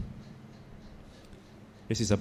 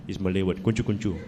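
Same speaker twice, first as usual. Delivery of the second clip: normal talking voice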